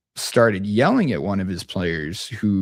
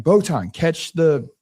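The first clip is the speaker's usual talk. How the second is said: raised, higher-pitched on-court holler; exasperated call-out; 8 semitones higher, 4 dB louder, much slower than usual. Retold or imitated. Player-coach to a teammate who missed a pass, exasperated call-out. Acted in-game outburst at player.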